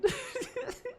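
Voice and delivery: high squeal. Real, not acted.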